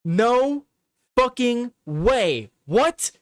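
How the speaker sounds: ordinary delivery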